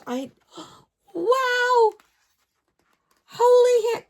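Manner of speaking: sharp gasp then high, loud wow; gasp of amazement. Real, not acted. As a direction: caught breathless by a surprise. Gasps at a discovery.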